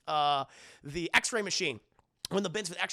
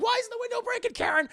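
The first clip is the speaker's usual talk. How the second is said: much higher, louder desperate cry; frustrated yell; 7 dB louder, faster than usual. Retold or imitated. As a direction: yell it at the suit. Peter struggling with window.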